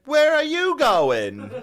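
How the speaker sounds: put-on high, slow, drawn-out old lady screech; mock the old woman calling after his car; shrill old lady. Old woman in the parking lot, mock high screech. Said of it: "And she's like".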